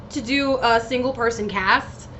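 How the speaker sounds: ordinary talk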